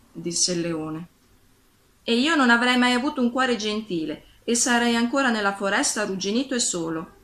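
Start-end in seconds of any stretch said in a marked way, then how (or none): none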